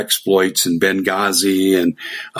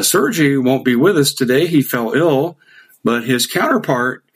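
ordinary delivery

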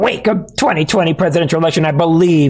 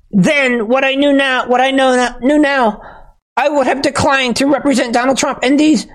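much higher, whiny fake-sobbing voice; mock tearful Jenna Ellis; 11 semitones higher than usual. Host mocking Ellis's tearful plea apology, whiny high mock sob. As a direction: ham up a weepy apology, pitched way up. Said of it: "her voice breaking at times".

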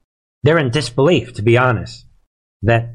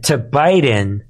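ordinary talk, nothing marked

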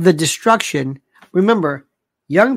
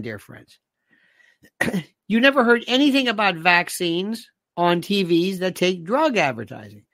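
ordinary talk

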